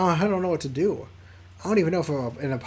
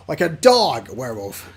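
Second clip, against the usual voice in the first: werewolf growl; 5 semitones lower, 7 dB louder than usual. Acted. Growled werewolf joke line.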